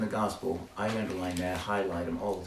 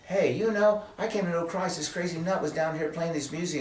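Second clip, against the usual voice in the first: higher, bright-eyed convert voice; acted eager testimony; 7 semitones higher, 3 dB louder than usual. Imagined convert tells how he met Christ, acting eager. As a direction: burst in high, then run on eagerly.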